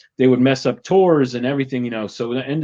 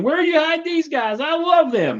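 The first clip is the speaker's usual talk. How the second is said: much higher, gushing cowboy billionaire; delighted cowboy. Retold or imitated. Cowboy billionaire gushes to the host, much higher and delighted. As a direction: squeal with delight at the wild guests. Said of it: "the cowboy millionaire saying".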